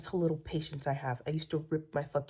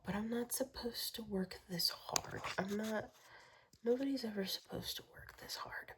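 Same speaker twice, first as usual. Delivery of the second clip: weary whisper; 5 semitones higher, 8 dB quieter than usual. Real, not acted. Exhausted complaint trailing to whisper.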